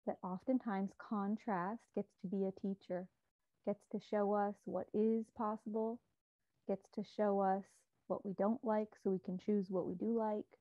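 calm, measured meditation guide with long pauses; unhurried, soothing meditation voice; guided meditation. Real, not acted. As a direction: guide listeners gently inward, leaving space between phrases.

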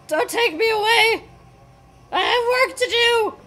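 high-pitched, hammed-up protest; mock pleading. Player as the hero being taken away, mock pleading.

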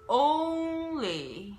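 a vocal coach modelling a word; English O in own. Shown as a demonstration. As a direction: own very slow and loud with a long rounded O gliding to U. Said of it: "例えばこのオーが入っている単語".

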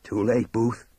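loud, grim verdict. Acted. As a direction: state it grimly, with force. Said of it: "Tookie says grimly".

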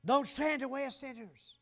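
loud, high pulpit warning; preaching shout. Real, not acted. Preacher warns congregation about sinners, shouting. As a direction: holler the command out.

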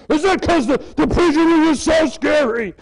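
a preacher mocking the idea with a loud, hammed-up question; mock scary. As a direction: ham up the scariness.

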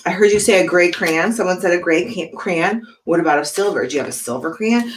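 ordinary delivery, nothing marked

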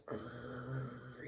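deep guttural growl. Retold or imitated. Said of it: "You know that growling".

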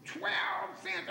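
very high-pitched outraged mock scream. Customer hearing the new tobacco price, mock screaming. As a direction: shriek it high in disbelief, imitating the customer. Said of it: "And he started screaming".

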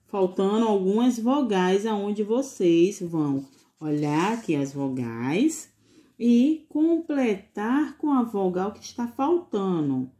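ordinary talk, nothing marked

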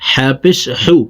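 usual speaking voice

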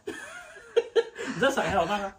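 high, slow, laughing; laughing tease. Real, not acted. Dad teases his wife on the scale, laughing. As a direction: draw it out through a laugh.

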